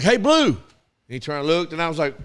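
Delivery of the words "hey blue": calling out to the umpire. Retold or imitated.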